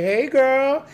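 high, bright mock-friendly hello; fake friendly greeting. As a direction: greet her like an old friend to fool them. Fake greeting to rescue girl.

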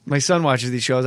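ordinary talk

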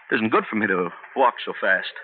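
ordinary delivery

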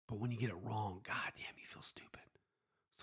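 whispered confession of feeling stupid; hushed whisper. Real, not acted. Bettor confesses to listeners about losing underdog picks, in a whisper. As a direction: drop to a breathy whisper, like admitting a secret.

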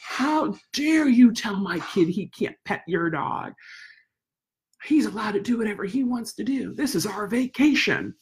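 slow, indignant mimic of an angry mother; irate mom. Mother scolding her about the dog, slow mock indignation. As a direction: sneer it like an entitled mom. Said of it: "the woman just got irate".

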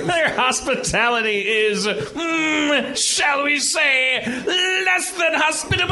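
high, drawn-out parody of an evil taunt; mock villain. Player rewrites the villain's line, mock villain voice. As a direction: stretch it out with sly theatrical pauses.

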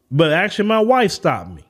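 ordinary talk

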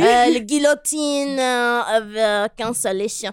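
halting mock-French accent; hammed-up French pronunciation. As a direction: search for words in fake French. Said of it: "You're doing a French accent".

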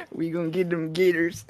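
put-on Southern American bayou accent. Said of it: "Of all the accents".

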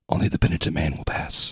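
whispered movie quote. Retold or imitated. Quoting a movie line.

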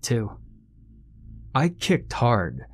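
plain reading voice

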